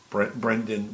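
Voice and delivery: ordinary talk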